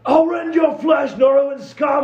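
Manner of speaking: high, loud villain war cry; hammed-up enemy battle yell; menacing enemy trooper. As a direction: shout a threat across the battlefield. Game master voices attacking enemy.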